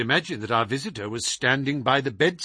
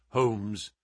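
plain reading voice

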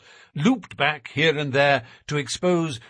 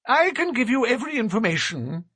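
high, thin nervous little man; 10 semitones higher, faster than usual. A narrator's reading. Narrator voices Thaddeus.

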